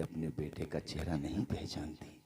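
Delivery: breathy hushed whisper. Acted. Preacher whispers that the mother doesn't know her son. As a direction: drop to a whisper for the reveal.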